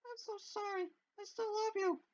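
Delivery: high, very soft tearful farewell; Monica's soft goodbye. Acted. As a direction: barely breathe it out as the girl Monica. Monica's last words, very soft.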